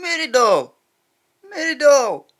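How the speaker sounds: lightly voiced copy of the character; imitating a TV character. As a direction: echo the show's voice on Mary doll. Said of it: "You can't mistake that voice for anyone else".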